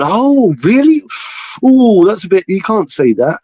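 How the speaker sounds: high, mock-shocked gasp of disapproval; a sarcastic pearl-clutching reaction; mock outrage. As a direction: ham up a scandalised listener. Mocking outraged reaction quote.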